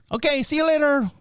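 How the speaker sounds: high, chirpy mock Spanish goodbye; mock Spanish farewell. Installer saying goodbye to homeowner in a mock Spanish accent. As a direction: breeze out with a chipper mock Spanish sign-off.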